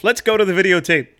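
a hint of a nightly-news sportscaster; Warner Wolf impression. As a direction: sell the catchphrase like a TV sports guy. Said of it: "I felt like Warner Wolf".

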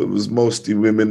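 ordinary talk

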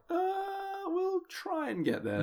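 very high, soft, hesitant voice; drawn-out high "uh", then a weak promise; feeble politician. Acted. Token opposition senator promising progress, feebly high and soft. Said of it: "he'll just be there like".

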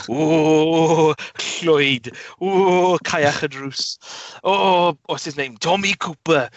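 hammed-up Welsh calling out names; crackly Welsh voice. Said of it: "some crackly Welsh voice".

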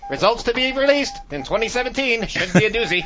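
put-on high, loud old-time newsreel announcer; old newscaster. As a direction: boom it out like a wartime newsreel. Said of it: "old newscasters".

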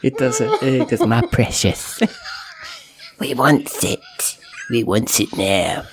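hungrily crave the treasure, drawing words out; Gollum. Acted. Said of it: "he does like Lord of the Rings".

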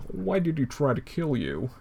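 lightly voiced puzzled frog; a hint of Kermit asking. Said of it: "Kermit just goes".

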